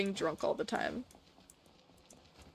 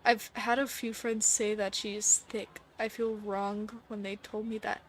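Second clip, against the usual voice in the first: normal talking voice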